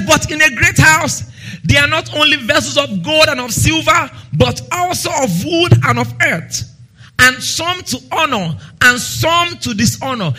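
scripture reading. Acted. Reads scripture in preaching voice.

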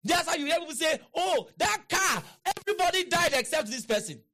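high, forceful pulpit retelling; exclaimed testimony in preaching. Acted. Preacher voicing people who tell of a crash, high and exclaiming.

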